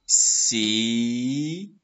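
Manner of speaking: "ciseaux" split by long pauses between syllables. Shown as a demonstration. Teacher choosing syllables to write, drawn out syllable by syllable. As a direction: stretch "ciseaux" out, one syllable at a time. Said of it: "on va choisir les syllabes".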